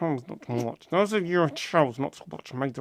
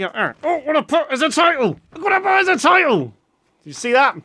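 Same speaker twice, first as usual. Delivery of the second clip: much higher and louder excited outburst; 11 semitones higher, 8 dB louder than usual. Real, not acted. Vlogger spotting his own video title, thrilled and loud. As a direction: burst out in thrilled disbelief.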